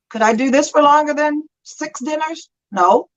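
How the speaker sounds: usual speaking voice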